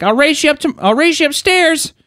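very high, giddy kid imitation; high-pitched hammed-up race challenge; kid recovering from tears. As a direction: squeal the challenge, mock-childlike. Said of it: "chuckling and, like, like, wiped off his tears".

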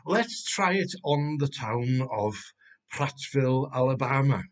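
ordinary talk

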